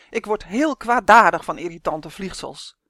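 loud, irate giant; loud, annoyed declaration; calling out. A narrator's reading. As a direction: boom the complaint without raising pitch much. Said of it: "riep de reus".

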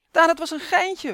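high, loud panicked call. A narrator's reading. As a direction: shout it to make him stop. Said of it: "roept Robin".

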